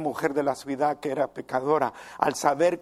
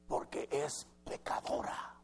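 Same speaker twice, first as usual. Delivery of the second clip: a contemptuous whisper; whispered to himself; 11 dB quieter than usual. Retold or imitated. The Pharisee condemning the woman to himself, scornful whisper. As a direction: hiss the verdict under your breath. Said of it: "dijo para sí".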